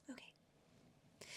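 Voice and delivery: breathy whispered echo, dropped way down. Acted. Vlogger echoing herself to viewers in a whisper. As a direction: whisper it back to yourself like a secret aside.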